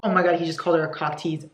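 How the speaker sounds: ordinary delivery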